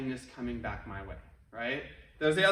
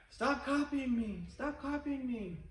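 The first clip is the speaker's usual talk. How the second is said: higher, slow, softer and softer; fading echo; 6 semitones higher, 5 dB quieter, slower than usual. Retold or imitated. The valley repeats the kid, fading out. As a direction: let the echo die away. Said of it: "they heard back".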